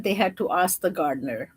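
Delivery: ordinary delivery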